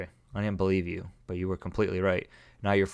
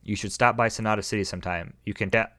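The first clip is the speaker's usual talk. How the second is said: ordinary talk, nothing marked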